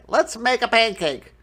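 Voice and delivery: put-on high, loud, fast boy voice; eager boy. A narrator's reading. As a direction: blurt it like an excited kid. Jack voiced in story.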